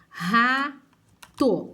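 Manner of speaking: RATO drawn out, opening on a strong H-like R; strong initial R. Shown as a demonstration. Teacher showing learners the word-initial R, strong R in "rato". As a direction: stretch "rato" with a hard aspirated first R. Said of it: "fica bem forte".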